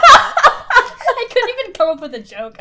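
loud high laugh breaking through words; laughing hard. Real, not acted. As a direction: crack up while trying to speak. Laughing fit at a joke.

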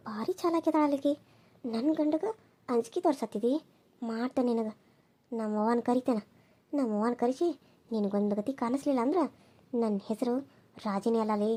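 low and hushed, plotting revenge to herself; young wife mutters her plan against her rival, low and hushed; scheming aside. Acted. Scheming second wife plots alone.

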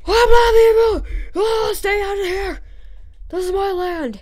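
high, loud outraged landowner; mock angry yelling. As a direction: yell to chase intruders off your land. Acted landowner chasing people off.

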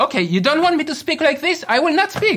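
high, loud, indignant voice; angry retort. Acted. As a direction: snap back at a partner, offended. Acted quarrel, offended by partner's remark.

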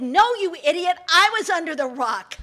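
irritated snake shouting at the fox; angry snake. Acted. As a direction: bark the correction at him.

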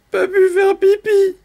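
very high, whiny mock whimper; whimpering little canary. Little canary excuses himself to the animals, whimpering. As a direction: whine it like a tiny bird. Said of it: "pleurniche le petit canari".

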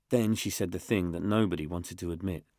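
usual speaking voice

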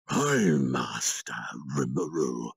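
a skeleton lord declaring victory for his lord; devoted undead king. Acted. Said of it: "that shouted that loudly".

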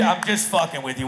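ordinary delivery

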